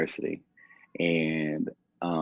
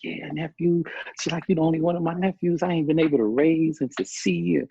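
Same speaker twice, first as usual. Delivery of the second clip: high, bright voiced excited auntie, higher; 10 semitones higher than usual. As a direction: play the thrilled auntie greeting her nephew.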